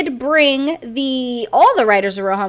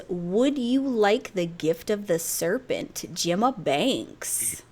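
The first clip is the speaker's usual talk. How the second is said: slow smooth pickup line; 6 semitones lower, 8 dB quieter, much slower than usual. Retold or imitated. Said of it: "whose pickup line is".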